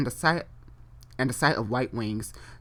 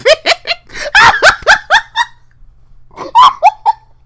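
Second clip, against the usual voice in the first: burst of shrieking laughter; 18 dB louder than usual. Real, not acted. Reacting to homophobic God line.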